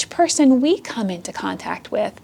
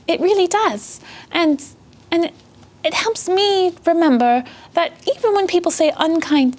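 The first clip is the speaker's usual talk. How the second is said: put-on higher, childlike puppy character voice; young puppy Thea, heartened; 4 semitones higher than usual. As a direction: speak as a little puppy who now understands. Plays Thea, a puppy, understanding now.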